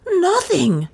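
very high, startled gasp of denial. A narrator's reading. Frightened butler denies what he saw, gasping. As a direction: gasp it high, falling at the end. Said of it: "he gasped with obvious untruth".

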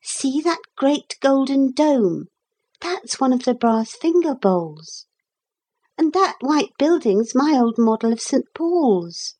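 put-on high-pitched girl revealing a secret; high, eager girl; excited girl character. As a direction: make them see it, as a little girl. Narrator voices girl Lucy pointing.

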